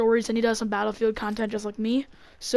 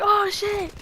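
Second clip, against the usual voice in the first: startled alarm; 10 semitones higher than usual. Retold or imitated. Group fleeing the chainsaw man, startled high yelp. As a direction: blurt it as a retold fright.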